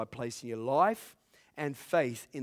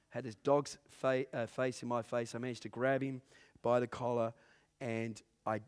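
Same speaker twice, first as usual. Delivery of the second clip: normal talking voice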